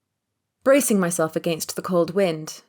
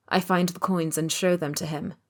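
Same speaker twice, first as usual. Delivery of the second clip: ordinary delivery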